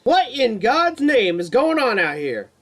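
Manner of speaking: high, loud alarmed cry; alarmed nurse. A narrator's reading.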